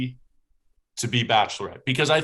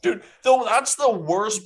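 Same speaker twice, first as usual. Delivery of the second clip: high, loud exasperated blurt; mock exasperation; 9 semitones higher, 3 dB louder than usual. Host mocks a contestant's choice, high and loud. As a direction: throw it out like it's obvious. Mocking Clayton's decision.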